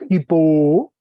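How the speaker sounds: hipo said loud with rising tune; teacher stressing hipo for class; Greek word spoken out. Shown as a demonstration. Teacher models Greek pronunciation for students, clearly. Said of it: "Y acento agudo".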